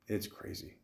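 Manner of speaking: "It's crazy." breathed out unvoiced to himself; whispered echo of "It's crazy"; whispered aside. Real, not acted. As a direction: drop to a whisper on the repeat. Whispered repeat.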